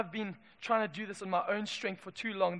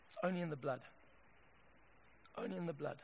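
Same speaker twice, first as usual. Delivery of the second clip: very soft, lowered voice; solemn hush; 4 semitones lower, 14 dB quieter, faster than usual. Real, not acted. Preacher to congregation after the angel passes, solemn hush. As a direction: drop right down after the climax, hushed.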